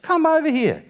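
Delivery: high, cooing call; high mock girlfriend voice; high-voiced girlfriend. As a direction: coax him over sweetly. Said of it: "she said".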